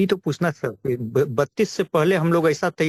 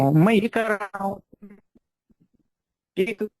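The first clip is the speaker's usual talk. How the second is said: bad connection; 4 semitones higher, slower than usual. Real, not acted. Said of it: "आपकी आवाज cut रही है".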